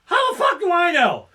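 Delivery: imitating his own loud irritated outburst; exasperated yell. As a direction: snap back at the stagehand. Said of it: "I'm like".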